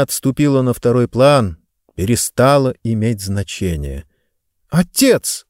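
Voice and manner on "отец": put-on a girl's high alarmed call, pitch jumps up; alarmed cry. A narrator's reading. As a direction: call out to her father in alarm. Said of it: "встревоженно воскликнула девочка". (встревоженный возглас)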